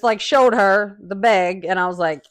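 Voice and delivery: normal talking voice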